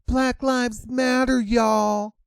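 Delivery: high, sing-song valley-girl mockery; mock influencer voice. Mocking a protester posing for photos, high and airy. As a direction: sneer at her as a vapid influencer.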